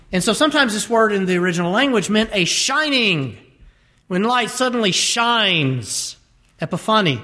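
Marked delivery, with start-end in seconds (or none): none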